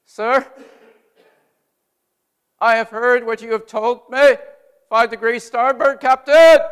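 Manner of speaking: loud, high repeated order; crisp call-back to the captain; wheelsman's reply. Retold or imitated. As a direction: sound off the heading back to the captain. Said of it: "he has to repeat exactly what the pilot told him".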